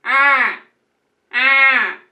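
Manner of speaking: loud cawing crow sounds; mock crow squawks. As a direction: caw like a crow, loud and harsh. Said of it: "you just hear".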